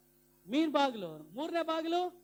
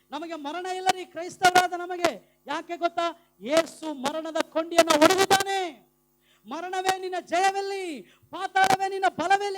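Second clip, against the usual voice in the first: high, loud pulpit proclamation; fired-up preacher at full volume; full-force preaching; 5 semitones higher, 10 dB louder than usual. Real, not acted. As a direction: shout it out as a triumphant challenge. Preaches victory over death.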